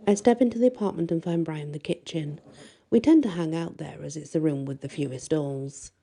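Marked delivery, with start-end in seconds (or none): none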